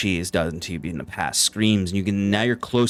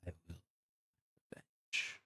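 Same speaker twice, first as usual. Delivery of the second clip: barely audible, eerie whisper; light whisper; 21 dB quieter than usual. Acted. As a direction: whisper it from far away in the night. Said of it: "you would just hear the light whisper".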